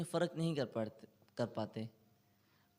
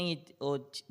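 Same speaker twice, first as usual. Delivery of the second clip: ordinary talk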